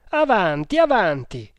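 high, loud repeated cry; ringing hero's shout; battle challenge. A narrator's reading. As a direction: dare them to attack, falling on the repeat. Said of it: "ripeté Tremal Naik".

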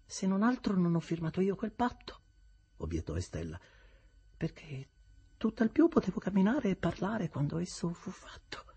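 lightly voiced cool young woman; calm, detached reply; Estella, cool. Estella answers Havisham calmly, lightly voiced.

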